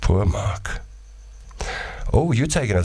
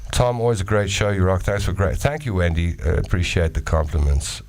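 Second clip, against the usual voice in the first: ordinary talk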